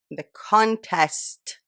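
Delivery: "contest" very slow, stretched syllables; careful retake. Real, not acted. Vlogger practising past a lisp, very slow "contest". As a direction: draw out "con-test" slowly. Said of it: "that's how I've been speaking".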